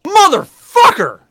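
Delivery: high, loud, drawn-out curse; exasperated shout. Real, not acted. Host hearing there are questions, loud exasperated outburst. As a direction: yell it out long and high.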